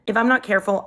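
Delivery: ordinary talk, nothing marked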